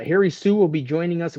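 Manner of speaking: normal talking voice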